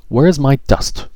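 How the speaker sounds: high, indignant demand; a fairy's annoyed demand; fairy cross at missing dust. Acted. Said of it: "She was cross".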